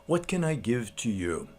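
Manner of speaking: unhurried, soft and serene, like someone who needs nothing; a quiet, peaceful monk offering the stone back; calm monk. Retold or imitated. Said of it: "very calmly says".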